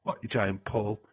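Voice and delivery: normal talking voice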